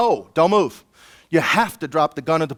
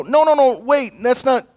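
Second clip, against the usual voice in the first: high, loud panicked no, no, no; blurting protest; 7 semitones higher, 3 dB louder than usual. Retold or imitated. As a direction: blurt out a correction in alarm. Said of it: "you go".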